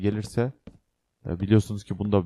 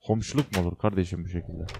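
usual speaking voice

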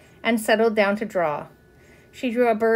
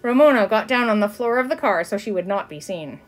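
ordinary delivery

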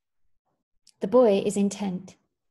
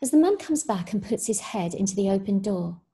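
ordinary delivery

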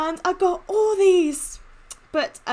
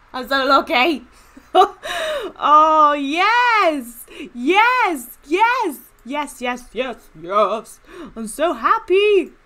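overjoyed squealing; 6 dB louder, much slower than usual. Real, not acted. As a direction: squeal it out like you just won. Real joy at rare chest pull.

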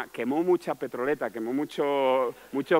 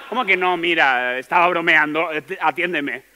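scolding; 3 semitones higher, 7 dB louder than usual. Retold or imitated. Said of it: "ya me estás como riñendo".

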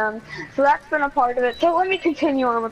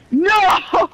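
loud, high, drawn-out cry; mock shouted protest; 8 semitones higher, 6 dB louder than usual. Acted shout inside retold voice message.

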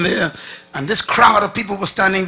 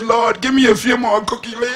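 joke-pray for more blessings; laughing mock prayer; 4 semitones higher than usual. Joking prayer after the check.

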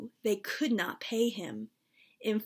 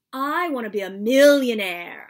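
loud eager boast; excited boast; 11 dB louder than usual. Acted. Child telling family she'll be rich, loud boast. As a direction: proclaim your dream loudly. Kids boasting about riches.

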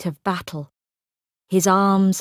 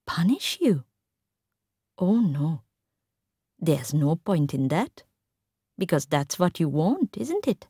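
quiet surprise. A narrator's reading. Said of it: "She appeared surprised".

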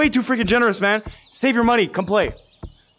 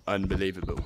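quiet disbelief; 10 semitones lower, 9 dB quieter than usual. Real, not acted. Disgusted mutter.

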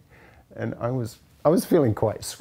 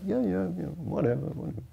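high, airy yeah-yeah of a boss who isn't listening; imitating a dismissive publisher; dismissive brush-off; 7 semitones higher, 7 dB quieter, faster than usual. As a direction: wave it away, bored and above it. Publisher brushing off overdue novel.

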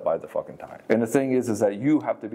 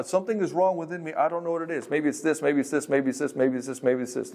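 higher voice, casting about for an answer; flustered searching; 6 semitones higher than usual. Retold or imitated. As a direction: imitate her anxious rambling in a higher pitch. Voices woman flailing for answers.

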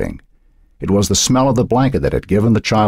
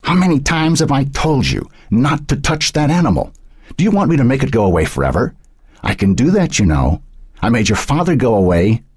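a higher, fast stream of rage; furious tirade; 8 semitones higher than usual. A narrator's reading. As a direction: threaten the child in fury. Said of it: "the furious words filtered through".

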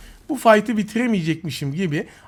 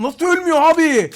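incredulous outrage; 8 semitones higher than usual. Real, not acted. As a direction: protest that the enemy won't die. Enemy survives two headshots.

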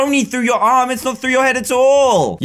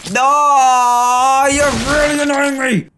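frustrated yell; 5 semitones higher, 3 dB louder, slower than usual. Real, not acted. Character fails again.

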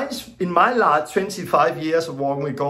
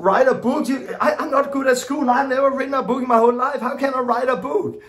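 higher, disbelieving, laughing in protest; incredulous protest; 6 semitones higher, faster than usual. Retold or imitated. Speaker protests to God he cannot write a book, incredulous and higher. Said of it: "And I was like".

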